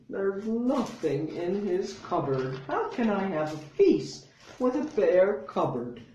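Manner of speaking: hint of a glum bear; gently disappointed bear voice; lightly voiced sad bear. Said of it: "bear laments empty cupboard".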